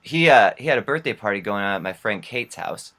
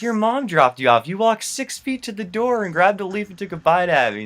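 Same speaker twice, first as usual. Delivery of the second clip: high, indignant mock outburst; incredulous scolding; 8 semitones higher, 3 dB louder than usual. Friend confronts him over bitten leaf, incredulous. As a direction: climb higher with each absurd detail, louder at first.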